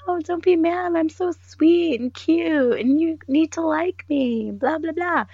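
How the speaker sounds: sugary manipulative coworker, mocked; mock sweet manipulative. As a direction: lay the fake cuteness on thick. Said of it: "weird manipulative thing".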